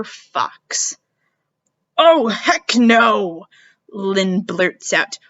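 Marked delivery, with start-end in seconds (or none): 2.0-3.5 loud blurt. Acted. Lynn blurts out.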